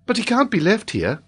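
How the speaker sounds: put-on high-pitched boy's protest; compassionate boy. A narrator's reading. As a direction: speak up high as a concerned boy. Robert voiced as compassionate boy.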